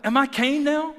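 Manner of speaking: loud, high defiant comeback; with an attitude. Retold or imitated. Talking back to God in anger, with an attitude. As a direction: throw it back with acted attitude. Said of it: "With an attitude".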